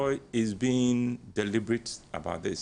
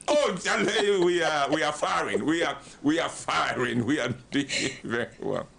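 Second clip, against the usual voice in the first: a cocky husband bragging to his buddy, put-on voice; boastful buddy voice. Husband telling his body buddy things are great, boastful. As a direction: brag it like a proud new groom. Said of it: "he asked him, 'Charlie, how are things going?'".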